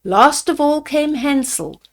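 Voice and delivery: usual speaking voice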